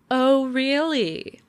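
slow, preppy faux-interest; sarcastic. Shown as a demonstration. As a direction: stretch it out with pointed mock interest. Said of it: "sarcasm, a well-aimed".

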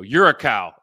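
blurted out loud, half joking; mock insult. Retelling a joke that backfired, blurted loudly. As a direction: fire off the jab loudly at his wife. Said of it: "And I go".

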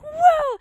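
loud high mock victory whoop; gloating whoop. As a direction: whoop like a cocky winner. Imitates winner's whoop.

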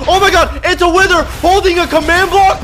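high, loud shocked report; alarmed yell. Real, not acted. Gamer spotting the wither, alarmed yell. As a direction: yell out what just appeared, panicking.

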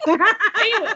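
high, loud laugh-filled anyway. Real, not acted. The host laughs at her own rambling, laughing. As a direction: giggle it out before getting going.

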